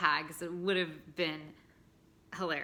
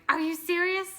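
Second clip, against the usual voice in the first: high, loud squeal of disbelief; shrieking mock disbelief at the news of twins; stunned disbelief; 10 semitones higher, 6 dB louder than usual. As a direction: replay the shocked "are you serious" on the ultrasound. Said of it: "four seconds of me going".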